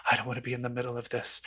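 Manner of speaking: low, soft, quick aside; hushed, hurried retold reluctance; reluctant bystander. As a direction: imitate someone backing quietly out of a fight.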